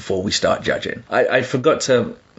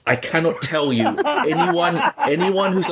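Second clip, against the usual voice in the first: laughing; 7 semitones higher, slower than usual. Real, not acted. Laughing at the British phrase.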